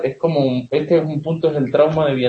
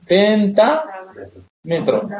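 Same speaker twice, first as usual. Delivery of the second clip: drawn out explanation; 5 semitones higher, much slower than usual. Shown as a demonstration. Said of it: "¿A qué hace referencia?".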